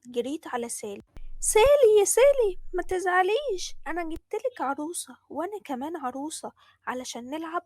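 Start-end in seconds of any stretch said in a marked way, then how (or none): 1.4-7.6 little sister calling out to comfort Sally; sweet child call. Acted. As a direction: coax her sister sweetly.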